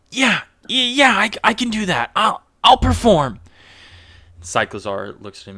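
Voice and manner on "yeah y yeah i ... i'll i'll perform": high, stammering eagerness; pitched-up voice, tripping over the yeses; eager performer. Acted. Game master as nervous contestant answering Bud, eager and stammering. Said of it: "and he goes".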